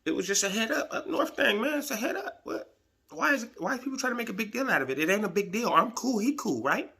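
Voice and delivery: retelling his own high, quick shrug-off to Kamau; mock casual protest. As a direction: sound breezy and baffled, like it is no big deal. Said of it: "So I'm like".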